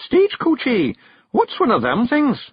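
high, loud Scots question; puzzled bearded passenger. A narrator's reading. Passenger asking the carter about coaches, puzzled high Scots. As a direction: ask baffled in a high Scottish voice.

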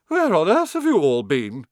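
high, loud scolding lady; indignant portrait lady. A narrator's reading.

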